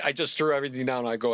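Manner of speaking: ordinary talk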